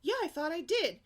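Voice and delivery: high, loud sing-song excuse; airy kid excuse. Retold or imitated. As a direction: play the careless daughter. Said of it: "She goes".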